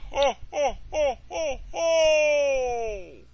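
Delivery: high, loud booming ho ho ho; Santa laugh. Acted. Santa mocking the naive boy, loud ho ho ho. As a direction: belt out a jolly laugh. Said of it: "He chuckled".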